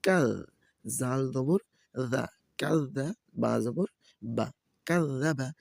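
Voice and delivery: slow, drawn-out syllable chanting; measured Quran-primer recitation; spelling drill recitation. Shown as a demonstration. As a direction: spell it out for learners, then join it. Spells out Arabic syllables for learners.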